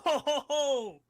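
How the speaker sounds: normal talking voice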